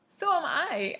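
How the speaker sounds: excited high-pitched squeal; sudden squeal. A narrator's reading. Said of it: "I squealed suddenly".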